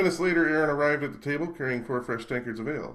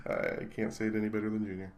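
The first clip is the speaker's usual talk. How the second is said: ordinary delivery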